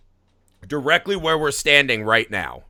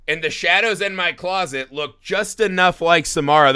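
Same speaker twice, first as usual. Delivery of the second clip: normal talking voice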